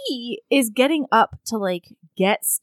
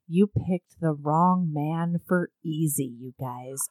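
low, soft, slow romance hero; seductive hero; 7 semitones lower, 8 dB quieter, slower than usual. Retold or imitated. Hero answers her in bed, low seductive voice. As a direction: voice a smouldering line, slowly. Retelling the hero's seductive line.

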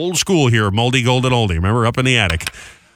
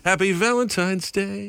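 slow, soft sweet greeting; tender wish; 7 semitones higher, 5 dB quieter, slower than usual. Real, not acted. Host wishing listeners happy Valentine's Day softly. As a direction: coo it gently to listeners.

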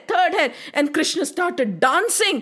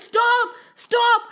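high-pitched acted begging; desperate plea; 9 semitones higher than usual. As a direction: plead as the beaten serpent. Overpowered serpent begs Krishna.

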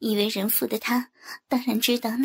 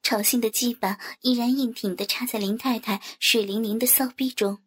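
plain reading voice

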